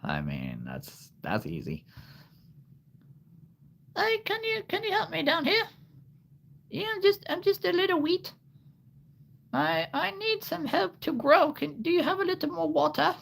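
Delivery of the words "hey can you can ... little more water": tiny, very high squeaky plant voice; cartoonishly high, small pleading voice; talking wheat plant. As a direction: plead like a little wheat stalk wanting help. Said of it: "I could probably make something up".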